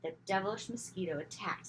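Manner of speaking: usual speaking voice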